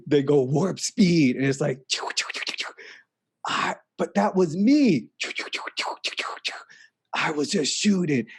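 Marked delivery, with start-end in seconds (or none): 1.9-2.7 soft unvoiced choo choo blasts; whispered warp blasts. Telling a friend about shooting through space, whispered blaster noises. Imitating Star Trek warp shots. 5.2-6.5 soft unvoiced choo choo blasts; whispered warp blasts. Telling a friend about shooting through space, whispered blaster noises.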